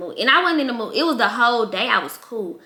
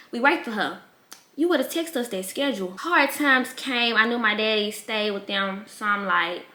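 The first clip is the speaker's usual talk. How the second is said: ordinary talk, nothing marked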